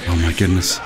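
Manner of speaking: ordinary talk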